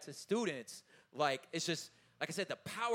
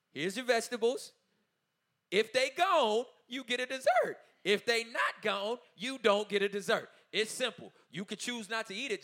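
firm parent voice, higher and louder; laying down the rule; 5 semitones higher, 5 dB louder than usual. Retold or imitated. Father tells his daughter the dinner rule, firm and louder. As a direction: spell out the rule to a child, no debate.

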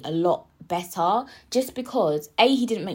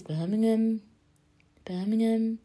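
put-on higher, drawn-out go at Birmingham accent; attempted Birmingham accent; 3 semitones higher, slower than usual. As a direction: say it in a badly imitated Birmingham accent. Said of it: "I can't do a Birmingham accent".

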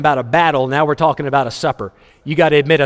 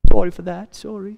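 put-on British accent; 4 semitones higher than usual. Preacher playing the polite guest apologizing, in a British accent. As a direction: say sorry like a polite Brit. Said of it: "I don't know why I went British on that".

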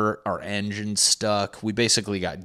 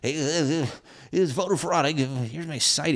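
much higher put-on dim guy voice; mock social-media guy; 7 semitones higher than usual. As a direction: play a worked-up guy citing his site. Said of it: "people who barely got through fucking high school".

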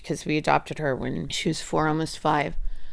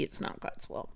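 resigned whisper; 14 dB quieter than usual. Retold or imitated. Mother giving up on finding her daughter a place, whispered. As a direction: breathe it out, voiceless and defeated.

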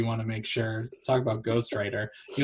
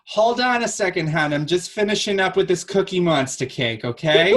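high, raised customer-service voice; busy bakery worker; 6 semitones higher, 4 dB louder than usual. Acted. Bakery worker stalling a ghostly customer, raised high voice. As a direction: call it out high over the counter. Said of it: "It's like".

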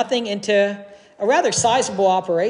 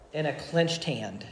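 soft; 5 semitones lower, 12 dB quieter than usual. Real, not acted. Sermon point dropped softly.

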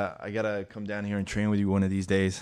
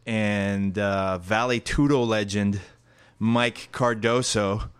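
loud hype show announcer; 9 dB louder, slower than usual. Acted. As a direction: boom it out like a ring announcer. Hype podcast intro over music.

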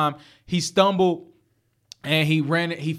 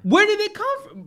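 mock incredulous. Echo of listener's question, squeaky.

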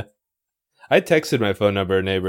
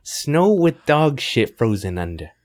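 put-on deliberate Chicago accent; put-on Chicago local, slowing down; Midwest accent; 4 semitones higher than usual. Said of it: "do a Midwest accent".

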